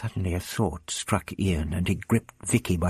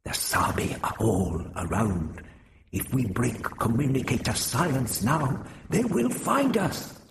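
fear creeping in as he warns; anxiously; 5 semitones higher than usual. A narrator's reading. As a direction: Zota warning Vrestin, growing frightened. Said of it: "He said anxiously".

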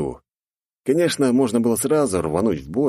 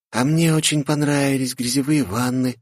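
put-on high cheerful girl cutting in; 7 semitones higher than usual. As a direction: chime in lightly. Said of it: "вклинилась в разговор Глория". (высокий девичий голос)

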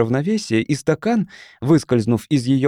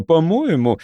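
usual speaking voice

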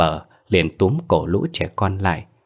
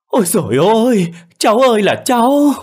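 high, loud keening wail; wailing and crying; 7 dB louder than usual. A narrator's reading. Grandfather running out, wailing for his drowned grandson. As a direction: cry out to the heavens for the lost grandson. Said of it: "vừa la vừa khóc".

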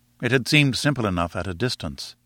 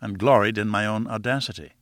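plain reading voice

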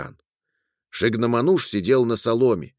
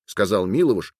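ordinary delivery

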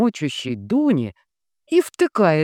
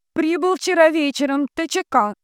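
very high, loud relay; high, forceful transmitting voice; entranced telegraph operator; 5 dB louder than usual. A narrator's reading. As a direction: chant the telegram out to the ether. Dunya repeats as transmitter.

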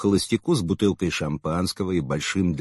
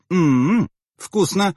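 pleased mmm; 9 semitones higher, 3 dB louder, much slower than usual. A narrator's reading. Tasting tea, drunk woman.

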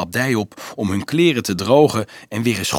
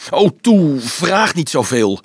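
loud, high burst easing into slower words; snapping, tearful; 5 semitones higher, 7 dB louder than usual. A narrator's reading. Dolf fends off Leonardo's questions, snapping near tears. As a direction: snap in upset exasperation. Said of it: "snauwde Dolf met tranen in zijn ogen".

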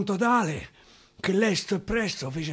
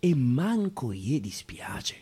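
softer, slower confiding whisper; hushed whispered aside; 7 semitones lower, 6 dB quieter, slower than usual. Acted. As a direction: let it slip out as a secret aside.

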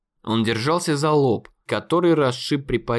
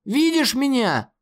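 higher, slower shouted видишь; shouting; 11 semitones higher than usual. Acted. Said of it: "закричал Конор".

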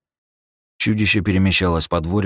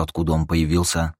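usual speaking voice

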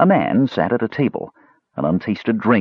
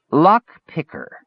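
"Lock picker" high and slow, each word stressed; "Lock picker" pitched up, deliberately separated; indignant emphasis; 8 semitones higher, slower than usual. A narrator's reading. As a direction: jab out "Lock picker" as a correction. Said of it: "jabbed the forked stick ... for emphasis".

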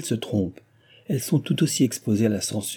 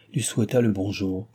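ordinary delivery, nothing marked

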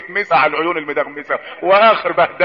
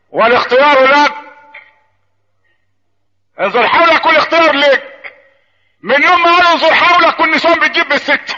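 high, ringing sermon delivery; full-force preaching; 7 semitones higher, 4 dB louder than usual. Real, not acted. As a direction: proclaim it to the crowd, building to a punchline. (خطابة حماسية بصوت عالي)

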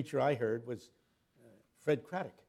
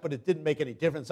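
ordinary talk, nothing marked

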